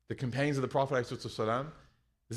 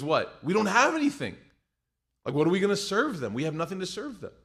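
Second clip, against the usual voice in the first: higher and louder panicked protest; alarmed wife; 7 semitones higher, 4 dB louder than usual. Retold or imitated. Wife to husband about guests, alarmed and high. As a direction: she can't believe it. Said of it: "she goes".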